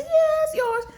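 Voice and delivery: very high, squeaky mock agreement; mock giving in. Owner giving up their car to a stranger, mock high-pitched surrender. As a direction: play a pushover caving in, way up high.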